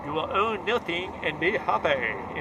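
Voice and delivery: slow, mock German accent; Klaus Schwab German accent. Quoting the globalist motto to viewers in a mock German accent. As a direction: drag the motto out slowly in a put-on German accent. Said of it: "in his German accent".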